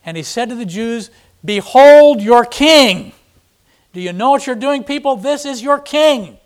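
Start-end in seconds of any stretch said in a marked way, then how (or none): 1.4-3.1 loud, slow, high proclamation; loud slow high "Behold your king"; proclaiming. Acted. Pilate presents Jesus to crowd. 3.9-6.4 high, loud appeal to the crowd; pleading. Acted.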